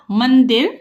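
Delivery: usual speaking voice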